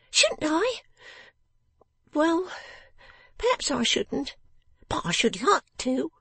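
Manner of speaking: very high, grudging little voice; stubborn little Jenny. Acted. Jenny half gives in to Lizzie, high and stubborn. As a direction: concede reluctantly in a high voice.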